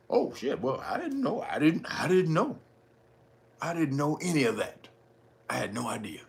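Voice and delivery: soft, whispered fake innocence; mock innocent whisper. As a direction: play dumb in a hush.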